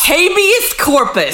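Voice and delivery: high, hammed-up lawyer voice; Sandy Cohen. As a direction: belt out legal jargon in a comic impression. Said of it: "getting excellent at Sandy voice".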